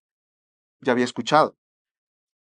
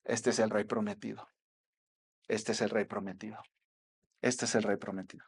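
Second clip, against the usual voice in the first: much softer, lower, hushed realisation; awed inner thought; 6 semitones lower, 13 dB quieter than usual. Retold or imitated. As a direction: murmur it to yourself in awe. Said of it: "Este ciego dijo".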